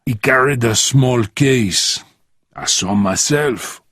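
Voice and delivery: put-on deliberate foreign-accented delivery; Santos, measured. Voices Santos.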